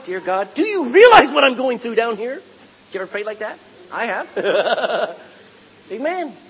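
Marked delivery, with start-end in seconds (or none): 0.0-2.4 louder, pleading complaint at God; a praying believer complaining loudly to God; exasperated loud prayer. Retold or imitated. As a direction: cry out the complaint, fed up. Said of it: "You ever prayed that way?".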